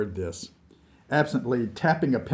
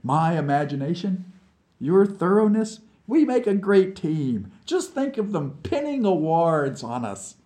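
higher, lively persuasion; coaxing; 8 semitones higher, 4 dB louder than usual. Acted. Vlad winning Nikita over with talk of awards, coaxing brightly. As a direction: sell the partnership with growing enthusiasm. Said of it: "Vlad soothed".

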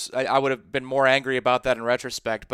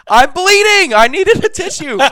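loud, high, laughing protest; indignant shout; 9 dB louder than usual. Retold or imitated. Host retells pleading with his teacher, high laughing yell. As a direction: yell it like a kid wronged by the teacher. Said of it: "I'm like".

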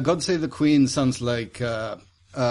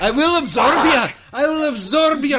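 high, raised mock-menacing voice; silly put-on voice; 12 semitones higher, 5 dB louder than usual. Player goofing off with the group, silly high voice. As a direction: pitch it up high for a silly threat.

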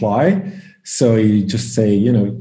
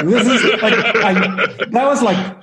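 laughing; 10 semitones higher than usual. Real, not acted. Laughing after the story.

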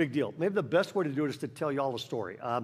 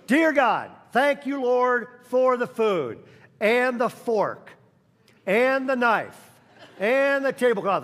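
put-on high, loud, slow little boy's grace; child praying; 5 semitones higher, 5 dB louder, slower than usual. Eager boy saying grace before the whole restaurant. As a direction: list each thing earnestly like a small kid.

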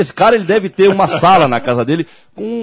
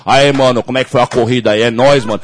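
lower-pitched Paulistano street slang voice; São Paulo mano accent; 5 semitones lower than usual. Retold or imitated. Host imagining a mano reporter asking about the race, Paulistano accent. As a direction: caricature a São Paulo homeboy. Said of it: "emissora dos mano paulista".

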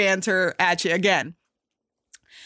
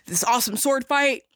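usual speaking voice

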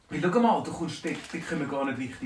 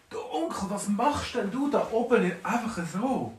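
calling up to uncle; 6 semitones higher, 4 dB louder than usual. Acted. Dwarf calls up to uncle.

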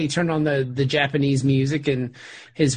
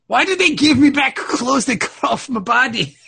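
high-pitched, hammed-up Scottish accent; indignant mock-Scottish wail; Connor MacLeod; 8 semitones higher, 4 dB louder than usual. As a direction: protest in a bad Scottish accent. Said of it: "I sound like fucking Connor McLeod".

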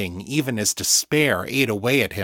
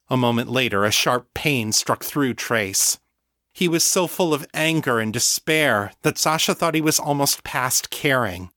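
ordinary delivery

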